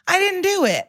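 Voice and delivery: very high-pitched mock innocence; mock denial. Podcaster mimics a husband lying despite video evidence, high mock denial. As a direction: squeak out a mocking, implausible denial.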